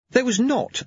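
ordinary delivery, nothing marked

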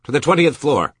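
loud, high, harsh command; harshest voice. A narrator's reading. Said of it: "said in his harshest voice".

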